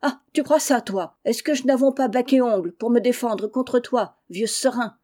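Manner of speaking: loud shrill scolding of her husband; angry shouting. Acted. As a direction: yell back at him, fed up. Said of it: "criant à qui mieux mieux". (femme qui crie contre son mari)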